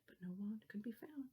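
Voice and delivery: whispered with hushed suspense. A narrator's reading. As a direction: breathe it out in a hush.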